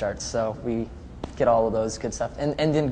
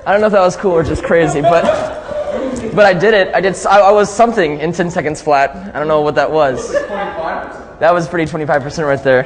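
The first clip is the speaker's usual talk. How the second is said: excited; 4 semitones higher, 8 dB louder than usual. Real, not acted. After doing it in ten seconds.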